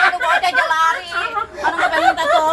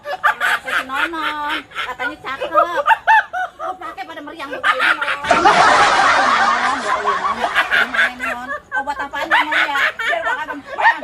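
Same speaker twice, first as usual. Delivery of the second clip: whiny lament; 10 semitones higher, slower than usual. Acted. Feverish man whines to Mon.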